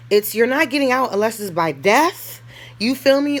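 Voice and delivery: ordinary talk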